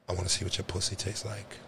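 low, soft seductive murmur; sexy voice. Retold or imitated. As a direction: lay on the bedroom voice. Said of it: "He put his sexy motherfucking voice on".

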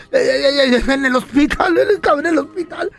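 high stammering excuse, whimpering; cowering husband. Acted. Late husband making excuses to his wife, whimpering. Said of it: "Y el pelón".

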